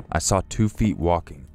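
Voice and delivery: plain reading voice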